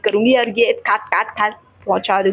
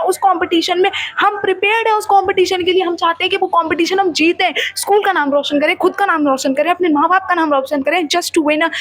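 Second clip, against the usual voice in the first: frustrated rant; 7 semitones higher than usual. Retold or imitated. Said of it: "हम भी like".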